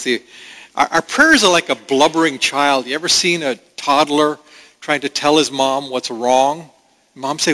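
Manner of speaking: ordinary talk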